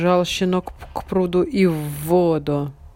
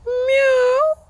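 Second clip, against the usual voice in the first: high drawn meow; cat meow; 5 dB louder than usual. A narrator's reading. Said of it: "кто-то сказал".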